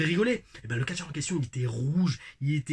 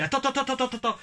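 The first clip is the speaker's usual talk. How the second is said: rapid high "attends" repeated; imitating an excited stop; 9 semitones higher, much faster than usual. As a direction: mimic a buddy halting everyone, much higher. [name] mime pendant la farce. (le pote qui crie attends, voix aiguë)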